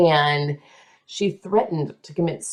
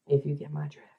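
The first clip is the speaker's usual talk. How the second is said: whispered aside, much softer; conspiratorial whisper; 4 semitones lower, 10 dB quieter, faster than usual. Acted. Telling her friend the scandal in a whisper. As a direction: lean in and breathe it like gossip.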